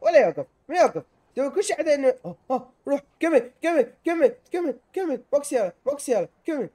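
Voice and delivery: high, loud, urgent commands; worked-up gamer yelling; frantic shouting. Real, not acted. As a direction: urge it on at fever pitch. Frantic game climax.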